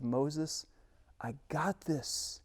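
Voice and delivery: very soft, slow God; almost murmured, fully in control; God calming Moses, very soft and slow; hushed assurance from God. Acted. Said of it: "God says".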